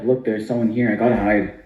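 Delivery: usual speaking voice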